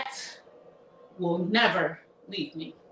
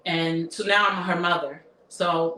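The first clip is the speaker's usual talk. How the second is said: usual speaking voice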